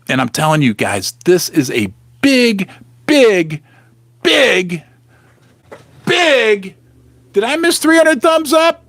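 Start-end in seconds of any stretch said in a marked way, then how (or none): 2.2-6.8 BIG repeated with long pauses, climbing higher; milk the word BIG for suspense; drawn-out build-up. Real, not acted. Teasing his viewers before revealing mom's shocking remark, drawn-out build-up. 7.3-8.8 high-pitched, showy surprise; mock-excited. Real, not acted. Streamer asking viewers about the thumbs-up milestone, mock-excited.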